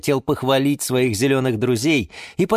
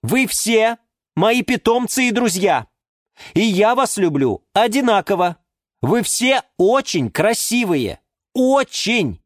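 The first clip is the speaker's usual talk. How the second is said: high-pitched emphatic appeal; exasperated; 8 semitones higher, 3 dB louder than usual. A narrator's reading. Юра insists he loves all plants equally, exasperated. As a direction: insist heatedly, patience gone. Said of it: "не выдержал Юра".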